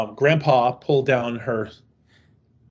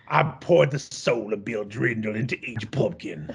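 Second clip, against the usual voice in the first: low, put-on old pitchman voice; mock pumpkin seller; 5 semitones lower, 4 dB quieter than usual. Host playing Grandpa hawking his haunted pumpkins, mock-solemn. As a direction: intone it like a hokey sinister salesman.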